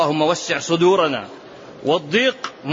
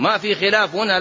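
ordinary delivery

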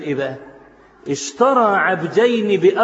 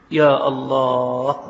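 awed sigh; 5 semitones lower, much slower than usual. Real, not acted. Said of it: "preacher's awed exclamation".

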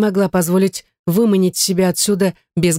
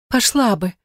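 higher, wailing plea; lamenting; 5 semitones higher than usual. A narrator's reading. Gayne assures David she would agree, lamenting. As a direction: wail it lightly, pleading. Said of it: "запричитала она".

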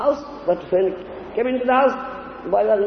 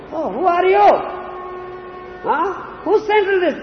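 surprised question; 8 semitones higher than usual. Acted. Guru's wife startled at the boy.

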